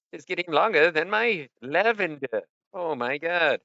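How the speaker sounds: lightly voiced fretting friend; imitating friend. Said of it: "he kinda talks like that".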